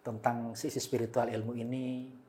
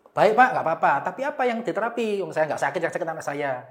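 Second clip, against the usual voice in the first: mother pushing back, higher and louder; mother insisting her child is the sick one, higher and louder; imitating the mother; 5 semitones higher, 7 dB louder than usual. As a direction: voice the indignant mother, raised. Mother argues she isn't sick.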